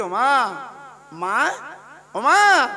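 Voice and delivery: loud calls of "ও মা"; calling out. Acted. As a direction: call to mother across distance. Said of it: "Abdullah ডেকে বলছে".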